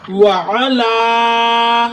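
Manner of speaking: وعلا very slow, final laa held long; stretched وعلا on a level note; held long vowel. Shown as a demonstration. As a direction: recite وعلا and linger on the laa. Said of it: "تھوڑی دیر رکیں گے".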